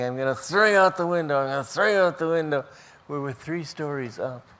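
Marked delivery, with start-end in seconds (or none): 0.0-2.6 loud, fast, higher threat from a bully; bully's threat. Retold or imitated. Bully beating the boy in his room, loud fast threat. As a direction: rattle it off louder and faster, menacing.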